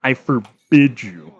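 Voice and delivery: slow, commanding decree; stern command. Pretend. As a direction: order it like a king. Commanding the game character.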